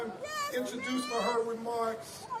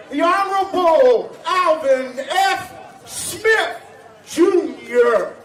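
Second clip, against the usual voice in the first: booming, much louder ceremonial proclamation; town crier; 5 semitones higher, 15 dB louder, slower than usual. Acted. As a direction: call it out like a herald.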